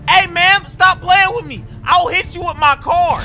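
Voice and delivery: high, loud threatening holler; shouted warning. Acted. Driver warning the shooter, shouted threat. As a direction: yell a threat across the street.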